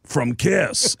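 a hint of Netanyahu voicing [name]. Shown as a demonstration. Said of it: "It's the same voice".

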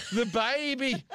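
hammed-up tearful cry for the baby; imitating wife crying out. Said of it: "my wife starts screaming, at, well, crying".

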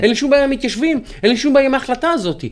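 high-pitched mimicked protest; mock protesting disclaimer. Quoting a protester who claims to back the settlement, mock insistence. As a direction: imitate someone insisting in a high voice.

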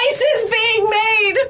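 high, slow, gleeful announcement; excited yell. Retold or imitated. Mother calling up to her son, high and excited. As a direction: call it upstairs, thrilled. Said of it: "I'm yelling up".

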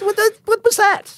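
baffled concert-goer. Retold or imitated. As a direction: react like lost at a concert. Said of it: "I'm like".

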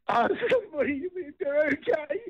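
squeezing the words out through a sob; crying. Real, not acted. Caller to hosts, pleading while crying, voice breaking. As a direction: voice breaking high into tears.

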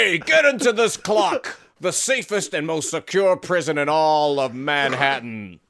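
gruff guard shout. Acted. Guard jailing prisoners in clock.

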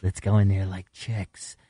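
creepy voice. Retold or imitated. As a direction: drop low and creep it out. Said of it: "He gets his creepy voice".

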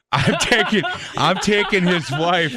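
chuckling while bragging about the pick; laughing. Real, not acted. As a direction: crack up mid-boast.

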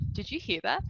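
lightly acted movie-theatre murmur; hushed aside. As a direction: re-enact a discreet question to a friend. Acted aside during the film.

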